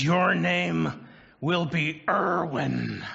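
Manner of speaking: drawn out like a village grandpa; old rustic grandfather voice. Retold or imitated. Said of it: "this old rustic voice is how Erwin makes it sound".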